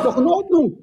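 high laughing dismissal. Real, not acted. Laughing during argument.

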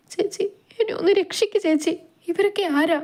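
put-on high, anxious girl's voice; helpless pleading. A narrator's reading. Captive girl asks an older woman for rescue, helpless and high. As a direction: implore helplessly, pitched high. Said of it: "Ammu നിസ്സഹായതയോടെ ചോദിച്ചപ്പോൾ".

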